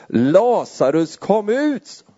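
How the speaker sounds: a slow, drawn-out call rising sharply on kom ut; read-out loud call. Acted. Preacher reading Jesus calling with a loud voice. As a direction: call Lazarus out of the grave. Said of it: "ropade han med hög röst".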